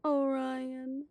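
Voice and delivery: ordinary talk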